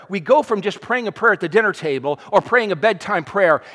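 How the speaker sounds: normal talking voice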